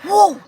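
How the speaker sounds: high whooshing "Whoa"; bat swoosh. Retold or imitated. The bat swinging over her head, high loud whoosh. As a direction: make the bat swoosh past your head. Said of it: "just hear the noise".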